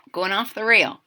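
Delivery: usual speaking voice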